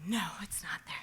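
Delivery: soft unvoiced whisper of dread; whispered realisation. Staring into the empty fridge, a whispered dread. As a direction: breathe it out, the truth sinking in. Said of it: "'Cause I'm like".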